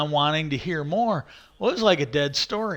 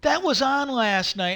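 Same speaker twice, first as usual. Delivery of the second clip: imitating a coworker, pitched well up; mildly excited retold exclamation, high-pitched; excited reply; 9 semitones higher than usual.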